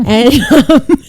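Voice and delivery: cracking up mid-word, high and loud; real laughing. Real, not acted. Speaker caught with smoothie on the nose, laughing. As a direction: burst out laughing while trying to talk.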